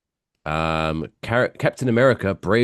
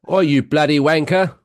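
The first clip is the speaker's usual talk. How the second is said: slightly raised mock-British insult; British accent; 7 semitones higher, 4 dB louder than usual. Co-host joining in on the cinema scolding, mock-British. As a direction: play along as an indignant Brit. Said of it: "with an accent".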